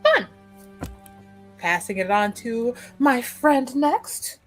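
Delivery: ordinary delivery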